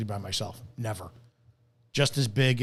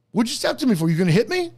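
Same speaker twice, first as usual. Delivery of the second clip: higher, challenging cop voice; mock cop confrontation; 8 semitones higher, 3 dB louder, faster than usual. Imitating the cop squaring up to the driver, mock challenge. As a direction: throw the challenge back like the cop did.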